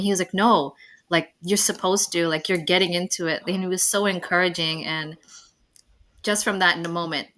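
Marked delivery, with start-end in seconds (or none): none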